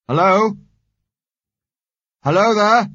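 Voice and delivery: loud high call into empty halls. A narrator's reading. Alone in the empty university, calling loudly for anyone. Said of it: "I called".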